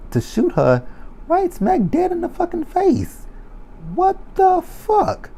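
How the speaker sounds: high, squealing disbelief; shocked squeal. Real, not acted. Viewer shrieking at the screen in shocked disbelief.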